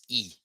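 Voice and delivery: "e" said as the vowel without the grave accent; unaccented e sound. Shown as a demonstration. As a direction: model "e" as the closer vowel, not open "eh". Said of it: "Without it, it would sound".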